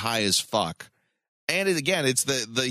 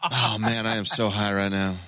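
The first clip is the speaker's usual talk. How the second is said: Towelie; 6 semitones lower than usual. Retold or imitated. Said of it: "It reminds me of South Park's Towley, where he's like".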